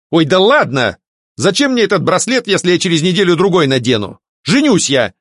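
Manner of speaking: breezy, high, cheerful declaration. A narrator's reading. Alfred brushes off the priest about bracelets, cheerful. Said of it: "весело заявил Альфред".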